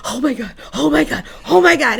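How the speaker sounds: mock panic. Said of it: "doing the whole panic".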